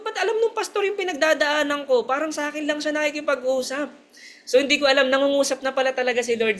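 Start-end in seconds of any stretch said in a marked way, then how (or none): 0.0-3.9 higher, wondering retold question; remembering the shock that the pastor knew her struggles; amazed. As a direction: recall the amazement, raised pitch.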